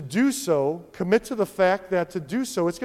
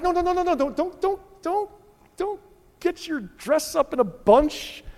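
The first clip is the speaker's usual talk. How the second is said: high-pitched, repeated don'ts; head off the objection in a high voice; mock pleading; 10 semitones higher than usual. Preacher heading off upset listeners, high mock pleading.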